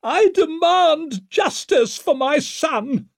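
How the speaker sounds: very high, drawn-out maternal outrage; slow, piercingly high cry; screaming. A narrator's reading. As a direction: scream the demand from a balcony. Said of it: "she screamed from the balcony".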